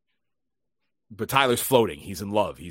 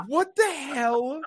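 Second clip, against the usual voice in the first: startled; 4 dB louder, slower than usual. Retold or imitated. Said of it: "I'm like".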